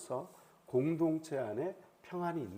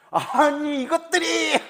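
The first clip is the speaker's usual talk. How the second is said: loud, high, acted furious shout; 격분한 호통; 10 semitones higher, 9 dB louder, slower than usual. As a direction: explode in rage at the people defying you. Acts out David's furious reaction. (버럭 높게 내지르는 성난 고함)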